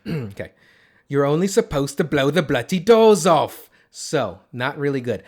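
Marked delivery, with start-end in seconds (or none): 1.1-3.7 slow, loud British impression, pitched up; Michael Caine. Podcaster retrying a Michael Caine line for his co-host, loud British impression. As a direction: belt it out in a put-on British accent. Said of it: "I'm gonna say that line again".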